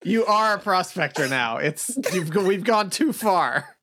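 ordinary talk

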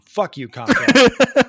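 loud, slow, high mock curse; hammed-up angry curse; mock outburst. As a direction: yell it at the company for a laugh.

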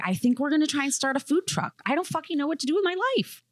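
fast, high, frantic rant; frantic rush. Retold or imitated. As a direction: blurt it out fast in a panic. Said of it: "I was like".

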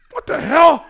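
high, loud, laughing what the hell; cracking up in shock at the fake shirt; laughing disbelief. Kid realizing his shirt is a knockoff, laughing disbelief.

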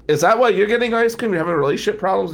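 high, fast, whiny pleading; hammed-up crying young lawyer; mock tearful question. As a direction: act out the weepy guy pressing him. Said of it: "And he's crying".

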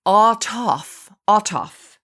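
"ataf" said as AH-tof, distinctly, twice; pronunciation demo. Shown as a demonstration. Host teaches listeners a Hebrew word, "ataf" pronounced distinctly. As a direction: sound out "ataf" carefully with open vowels. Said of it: "it is pronounced ataf".

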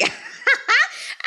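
high airy laugh burst; real laugh, high and breathless. Real, not acted. Host laughing at Karen's shade, high and airy.

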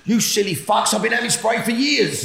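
imagined angry retort to paramedics; frustrated outburst. Retold or imitated. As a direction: vent at the paramedics. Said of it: "I wanted to say".

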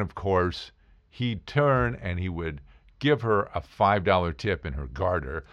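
normal talking voice

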